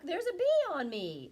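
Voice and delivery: loud, high complaining duck. Acted. Duck complaining to children that a bee sits on it. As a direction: squawk it like an upset duck. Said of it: "said the duck".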